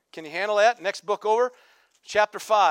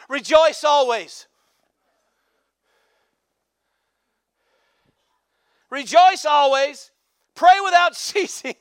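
raised, loud, rhythmic exhortation; fervent preaching; 6 semitones higher, 7 dB louder than usual. Acted. Pastor urging church to persevere, fervent preaching. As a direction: keep the congregation fired up.

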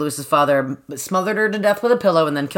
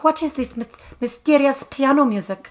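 high curious wonder; 8 semitones higher than usual. Retold or imitated. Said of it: "it's like".

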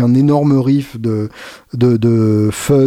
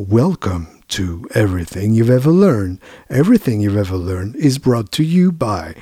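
robotic TV announcer. Retold or imitated. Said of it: "genre annonceur de télé mais complètement robotisé".

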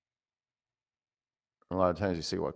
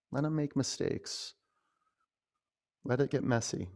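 usual speaking voice

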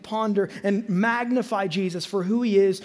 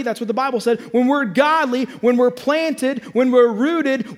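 preaching at full force; 4 semitones higher than usual. Acted. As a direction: drive each phrase home. Preaching builds, slow and loud.

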